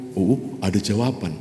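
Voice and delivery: usual speaking voice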